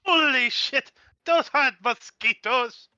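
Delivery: shocked shout. Real, not acted. Player shocked by monsters.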